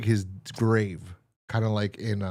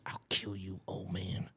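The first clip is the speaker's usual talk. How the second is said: a whispered threat, much softer; menacing whisper; 3 semitones lower, 12 dB quieter than usual. Retold or imitated. The killer whispering a threat to the old man. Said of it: "he goes".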